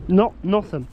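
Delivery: loud sharp no to the dog; telling off the dog. Real, not acted. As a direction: stop the dog, loudly. Stopping the dog.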